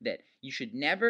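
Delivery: ordinary delivery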